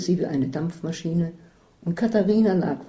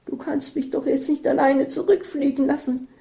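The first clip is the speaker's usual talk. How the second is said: higher, whining plea; whine, begging her not to leave; 9 semitones higher than usual. Acted. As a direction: friend begging not to be left behind, whiny. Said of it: "jammerte Isabel".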